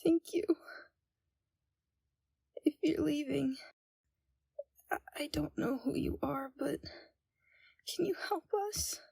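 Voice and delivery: hushed fearful whisper; frightened whisper. Acted. Captive Flora whispering to a stranger, frightened whisper. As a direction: whisper so the captors won't hear.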